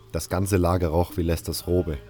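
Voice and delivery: ordinary talk, nothing marked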